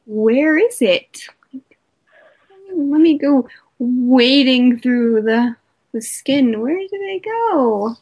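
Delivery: hunt for something tiny in mock confusion; mocking woman searching for a small penis.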